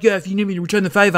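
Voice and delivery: hurried chatty impression of a crush; Andrew. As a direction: rush it out high, imitating him. Voicing his reply as before.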